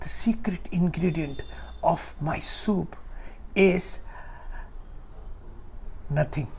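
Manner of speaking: a hushed whisper, sharing the big secret. Acted. Father whispers the secret to his son, hushed. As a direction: lean in and breathe it like a secret.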